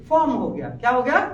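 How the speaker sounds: ordinary talk, nothing marked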